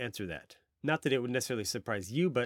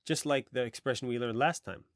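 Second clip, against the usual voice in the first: ordinary talk, nothing marked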